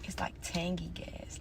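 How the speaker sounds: breathy whisper, soft and close; hushed whisper. Real, not acted. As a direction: murmur it like a secret. Describing the smell quietly.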